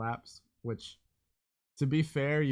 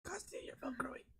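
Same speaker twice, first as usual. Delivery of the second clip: whispering; 13 semitones higher, 13 dB quieter than usual. Real, not acted. Hushing noisy table.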